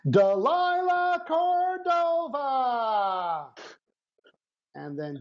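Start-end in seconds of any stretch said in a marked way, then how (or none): 0.1-3.5 high, drawn-out announcer call; game show host voice. Acted. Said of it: "the Vibe is Right game show voice".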